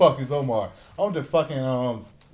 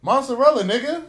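much higher, louder cry; excited blurt; 12 semitones higher, 7 dB louder than usual. Real, not acted. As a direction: burst in with your pick. Blurting his cheese choice.

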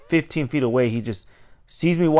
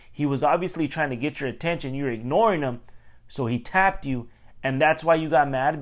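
usual speaking voice

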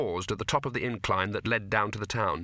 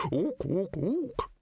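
high ape hoots; ape librarian; 9 semitones higher, much slower than usual. A narrator's reading. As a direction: hoot like an ape, climbing higher.

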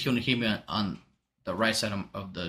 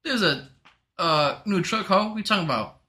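retold indignant comeback; 7 semitones higher than usual. Retold reply to the wife.

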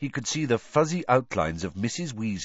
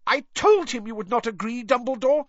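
high, loud furious outburst; stormed; 4 dB louder than usual. A narrator's reading. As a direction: storm at him in outrage. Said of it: "stormed Professor McGonagall".

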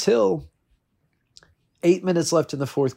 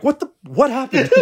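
much higher, cracking what-the; can't believe the score; stunned disbelief; 13 semitones higher, 4 dB louder than usual. Retold or imitated. Checking the fantasy score, high disbelief. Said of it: "I'm like".

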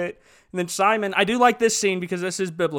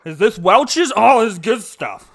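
louder, mock-delighted exclaiming; impressed guest; 5 dB louder, slower than usual. Wedding guest raving over cheap grape juice, mock delight. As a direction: play up being impressed by the juice.

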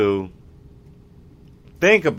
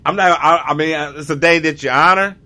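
ordinary talk

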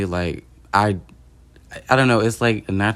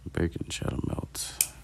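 slow, whispered ASMR voice; breathy whisper into the mic, slowly; 4 semitones lower, 13 dB quieter, slower than usual. Pretend. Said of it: "I'm not gonna do the A S M R".

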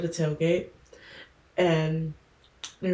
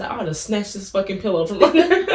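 laughing, louder threat; 4 semitones higher, 6 dB louder than usual. Retold or imitated. Retold threat while laughing.

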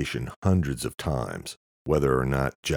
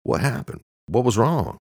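serious; 10 semitones higher, 5 dB louder than usual. Acted. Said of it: "Eamon asked seriously".

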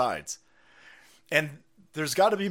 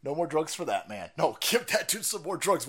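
usual speaking voice

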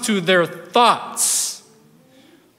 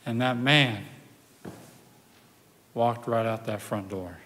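low, soft voice with long suspenseful pauses; hushed sermon storytelling; 8 semitones lower, 7 dB quieter than usual. Acted. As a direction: let the miracle unfold quietly, phrase by phrase. Hushed dramatic telling of the miracle.

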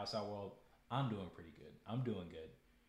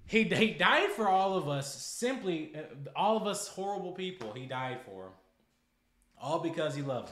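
impassioned preaching; 6 semitones higher, 10 dB louder than usual. Real, not acted. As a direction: cry it out like a revival sermon. Emotional preaching about Christ.

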